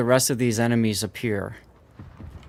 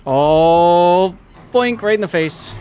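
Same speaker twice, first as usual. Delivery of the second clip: excited; 5 semitones higher, 8 dB louder, much slower than usual. Real, not acted. Hit scored in a naval battle.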